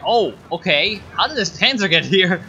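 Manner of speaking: sudden jump up in pitch and volume, caught off guard; surprised. Real, not acted. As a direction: blurt it out, startled by the tank. Surprised at a Panzer appearing.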